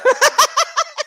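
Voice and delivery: high, loud burst of laughter; real shriek of a laugh. Real, not acted. Streamer reacting to chat's joke with a loud shrieking laugh.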